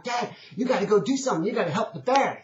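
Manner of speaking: put-on urgent high-pitched boy's plea; upset little boy. Little boy tugging on his dad's arm, upset. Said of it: "he's incredibly upset".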